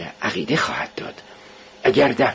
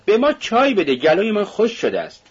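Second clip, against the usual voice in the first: higher, raised call for tea; shouted call; 10 semitones higher than usual. Acted. Razumikhin calling to his host for tea, a raised shout. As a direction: call out for tea over the room. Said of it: "رازومیخین فریاد زد".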